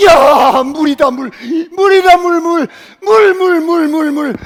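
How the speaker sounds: loud high cries of joy; joyful shouts. Acted. Thirsty people finding water, joyful shouting. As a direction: cheer at the gushing water.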